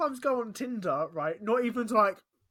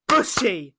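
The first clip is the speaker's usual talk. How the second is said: loud call-out; 3 semitones higher, 10 dB louder, slower than usual. Real, not acted. Calling out cousin's hometown.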